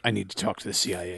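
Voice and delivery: low, soft and quick, under the breath; hushed demand. Retold or imitated. Ken Wall demanding the CIA at gunpoint, low and soft. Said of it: "and is like".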